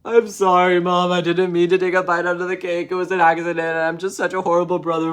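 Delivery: loud, high hammed-up wail; mock bawling. David confessing to Mom at night, loud fake bawling. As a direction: wail the apology like a sobbing kid. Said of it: "bawling his eyes out".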